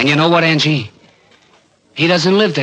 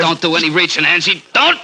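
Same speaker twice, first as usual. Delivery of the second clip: sharp, raised and higher warning; alarmed shout; 6 semitones higher than usual. Acted. Charlie yelling at Angie not to reach, alarmed shout. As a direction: stop him before he draws.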